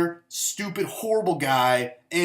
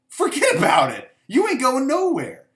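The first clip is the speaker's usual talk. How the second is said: taunting; 9 semitones higher, 5 dB louder than usual. Real, not acted. Taunting weak team.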